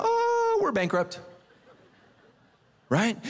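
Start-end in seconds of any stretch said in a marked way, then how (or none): 0.0-1.2 mock panic. Panic joke about finances.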